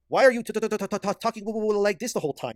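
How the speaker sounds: high, loud mock stammer on every t; mocking stutter. Mocking the streamer's trembling voice with a stammer. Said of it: "why is this guy's voice trembling".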